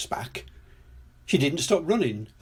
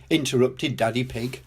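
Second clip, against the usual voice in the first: ordinary delivery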